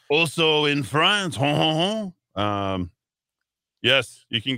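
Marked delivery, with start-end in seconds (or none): 0.1-2.4 higher, slow, hammed-up French with chuckle; mock French accent. Host moves on to news from France, mock French accent.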